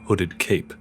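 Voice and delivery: ordinary delivery, nothing marked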